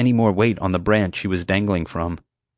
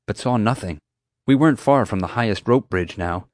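ordinary delivery

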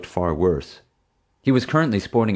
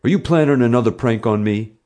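louder, higher accusing bully; demanding; 5 semitones higher, 5 dB louder than usual. A narrator's reading. Bully accusing the boy of planning another prank, demanding. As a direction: press the accusation forcefully. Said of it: "he demanded".

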